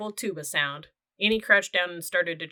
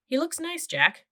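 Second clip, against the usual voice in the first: put-on cheerful girl; 10 semitones higher than usual. A narrator's reading. Girl voice, delighted.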